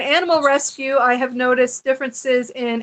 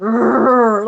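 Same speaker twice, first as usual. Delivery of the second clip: low growling rumble; deep dog growl; 4 semitones lower, 5 dB louder than usual. Retold or imitated. Woman imitating her old dog's deep growl. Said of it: "she was so deep".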